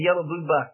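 "yellow blue bus" said as English words for Russian "I love you"; mock Russian phrase. Shown as a demonstration. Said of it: "that's not pronounced exactly right".

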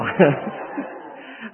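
breathless helpless laugh; airy laugh caught off guard; real laughter. Real, not acted. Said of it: "I lost it".

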